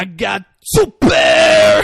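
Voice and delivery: slow, high, booming boast; hyped. Retold or imitated. Imitating the card player who thinks he won, hyped yell. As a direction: blow up on a stretched-out yell. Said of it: "So hyped".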